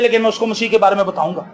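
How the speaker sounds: ordinary talk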